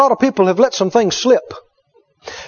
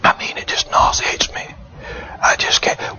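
imitated feeble whisper of a patient; weak and sick; 6 semitones lower, 7 dB quieter than usual. Sick person telling the minister food nauseates them, weak whisper. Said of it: "They, they were saying".

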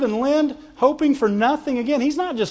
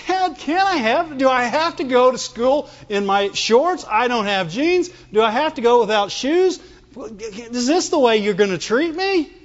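mock child pleading with Dad; whining kid; 3 semitones higher than usual. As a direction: imitate a complaining kid, slightly pitched up. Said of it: "your kid came and wanted something".

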